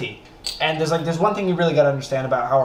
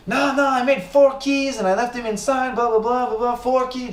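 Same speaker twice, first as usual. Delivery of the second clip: imitating a thick French accent, much higher; 9 semitones higher than usual. French neighbor rambling about his keys, thick French accent. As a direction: babble it in a heavy French accent. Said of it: "he speaks with a very thick French accent".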